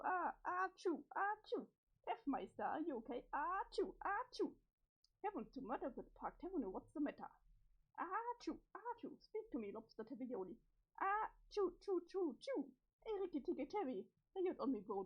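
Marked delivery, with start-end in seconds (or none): 0.0-1.7 loud hammy sneezes; big theatrical sneeze; sneezing. Acted. Sneeze fit, friend asks okay. 3.3-4.5 loud hammy sneezes; big theatrical sneeze; sneezing. Acted. Sneeze fit continues. 8.0-9.1 high squeaky sneezes; sneezing. Acted. Sneeze fit continues. 11.0-12.7 loud desperate sneeze fit; sneezing. Acted. Sneeze fit, asked about hospital.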